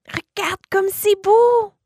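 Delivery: put-on high, slow little boy voice; child's voice. Boy realizing what his book meant, in a high child voice. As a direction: think it aloud like a wondering child.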